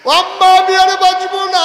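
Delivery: high, loud sung wail for mother; labouring mother's sung lament; wailing cry. Acted.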